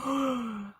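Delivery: sharp startled intake of breath, then a soft breathy word; shocked gasp. Retold or imitated. Retelling a photo of her neck, she gasps in shock. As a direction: gasp as if you just saw your sagging neck. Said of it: "and I'm like".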